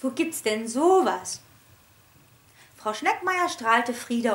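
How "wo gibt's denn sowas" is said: ordinary delivery, nothing marked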